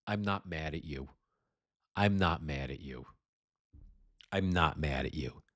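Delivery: soft, calm repeat with a smile; gentle, smiling reassurance. Retold or imitated. Retold woman calmly telling officers, softly smiling. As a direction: quietly soothe the officers, smiling. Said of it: "with a smile on her face".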